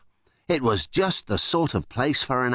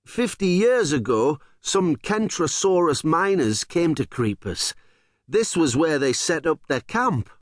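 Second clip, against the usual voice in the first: shouting over wind; 6 semitones higher than usual. Acted. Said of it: "shouted to him over the wind".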